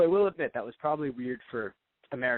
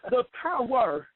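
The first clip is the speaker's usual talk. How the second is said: high, very slow, drawn-out proclamation; mock preacher intoning, much higher; hammed-up preacher; 8 semitones higher, much slower than usual. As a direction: stretch it out like a mock sermon. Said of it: "an over-exaggerated preacher".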